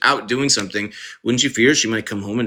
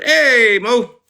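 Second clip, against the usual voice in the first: mock cheery greeting; 12 semitones higher, 5 dB louder than usual.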